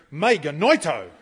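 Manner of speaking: loud, pompous Greek retort; emphatic, self-inflated. Shown as a demonstration. Retorting to someone in Bible study, loud and pompous. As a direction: puff up and declare it with a laugh. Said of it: "be emphatic in a Bible study group".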